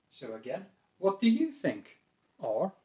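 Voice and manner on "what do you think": "What do you think?" lifted high on YOU, nice neutral tone; raised questioning intonation. Shown as a demonstration. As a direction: raise "you" and ask with interest. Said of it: "So raising the intonation".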